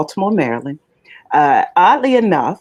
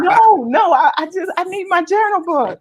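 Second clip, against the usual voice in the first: much higher, laughing-bright correction; retold giddy answer. To the mailman who asked if she wrote it, high giddy reply. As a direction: play her past self, still buzzing. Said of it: "And I said".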